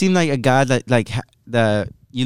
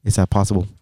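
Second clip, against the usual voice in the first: low, monotone imitation of a friend; Danny's monotone voice; 5 semitones lower than usual. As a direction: drone it low in one tone. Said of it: "you have a monotone voice, like".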